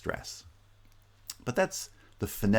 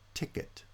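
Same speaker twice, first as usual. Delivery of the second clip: "ticket" stressed on its first syllable, weak ending; 10 dB quieter than usual. Shown as a demonstration. Lecturer repeating the example noun, first-syllable stress on "ticket". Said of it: "tend to have initial stress".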